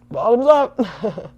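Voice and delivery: loud, high, slow toast; cheerful toast. Real, not acted. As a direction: raise the glass and call it out. Toasting before drinking.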